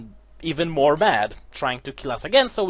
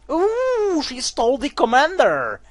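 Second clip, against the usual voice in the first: mock surprise; 10 semitones higher, slower than usual. Real, not acted. Reacting to the twist.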